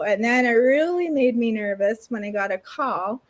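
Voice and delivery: ordinary talk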